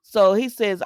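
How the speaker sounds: normal talking voice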